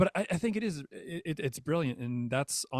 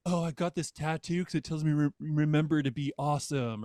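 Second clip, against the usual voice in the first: louder, higher, mock-earnest; 4 semitones higher, 6 dB louder than usual. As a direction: parody a cheesy tattoo owner. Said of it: "I hate when people are like".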